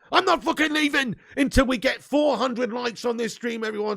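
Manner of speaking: rally the chat with a shout; half-joking rallying cry to the chat; playful shout. Real, not acted. Streamer demanding likes, shouting.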